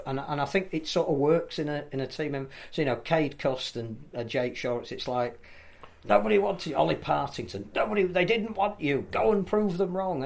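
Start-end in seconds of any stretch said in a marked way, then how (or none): 6.0-10.2 rallying coach. Retold or imitated. Voicing coach rallying players.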